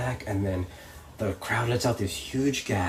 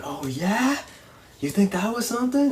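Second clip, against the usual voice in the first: high, taunting mock challenge; trash talk; 10 semitones higher than usual. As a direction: imitate a wrestling heel goading the crowd. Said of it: "he's, like, talking trash to him".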